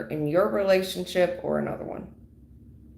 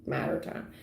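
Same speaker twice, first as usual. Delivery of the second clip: whisper; 10 dB quieter, faster than usual. Shown as a demonstration. Ominous repeat of prediction.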